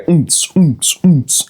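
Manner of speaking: punchy vocal dance-beat thumps; rhythmic "oomps" beatboxing; imitated club beat. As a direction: mimic thumping club music with the mouth.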